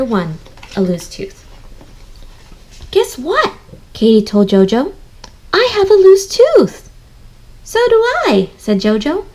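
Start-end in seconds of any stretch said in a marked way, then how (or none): 2.9-3.5 put-on high excited little girl; Katie's voice. Teacher reading Katie to the class, high and eager. 5.5-6.9 put-on high, loud excited child; Katie's voice. Teacher reading Katie telling Jojo, high and loud. 7.6-8.5 put-on high eager child; Jojo's voice. Teacher reading Jojo answering Katie, high and eager.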